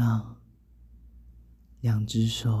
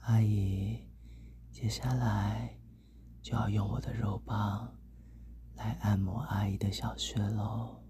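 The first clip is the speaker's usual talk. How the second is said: hushed, close to the ear; speaking softly; 7 dB quieter than usual. A narrator's reading. Leaning in to the listener's ear, speaking softly. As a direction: drop to a soft, intimate murmur. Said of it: "小声地说".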